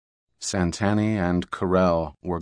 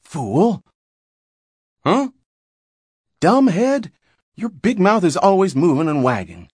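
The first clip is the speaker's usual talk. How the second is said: Mama hisses at Papa, then shouts higher and louder; hissed insult; 10 semitones higher, 4 dB louder than usual. A narrator's reading. Mama scolds Papa for blurting war news, a high loud snap. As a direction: snap at the husband, sharp and loud.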